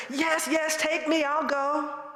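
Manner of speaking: eager, bright yes. Retold or imitated. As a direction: leap at the chance. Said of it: "I said".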